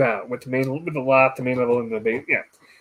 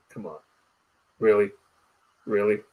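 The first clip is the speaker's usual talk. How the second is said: eye-rolling sister; 6 semitones lower, 5 dB quieter than usual. Retold or imitated. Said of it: "rolling her eyes".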